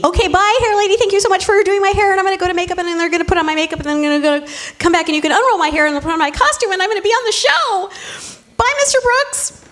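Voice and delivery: overly perky self. Retold or imitated. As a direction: gush like an eager newbie on her first day. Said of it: "I was a little too perky".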